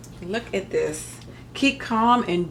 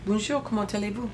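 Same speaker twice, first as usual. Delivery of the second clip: attempted French; 4 dB quieter, faster than usual. Shown as a demonstration. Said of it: "That's my extent".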